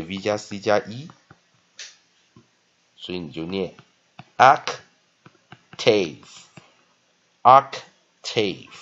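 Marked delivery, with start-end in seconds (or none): 4.4-8.7 "octave" very slow and loud, OK ... TAYV; double stress. Shown as a demonstration. Teacher demonstrating the double-stress reading, "octave" loud and stretched on both syllables. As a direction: split "octave" into two equally stressed syllables. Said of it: "第一个音节跟第二个音节都有重音".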